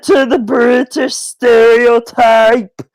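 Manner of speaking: slow, high, drawn-out outrage; hammed-up indignant British critic; mock outraged Brit. Speaker imitates British people flaming her, mock outrage. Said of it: "people are probably gonna flame me".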